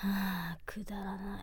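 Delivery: whispered, slow and fed up; bored whisper. Real, not acted. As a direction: scoff under your breath.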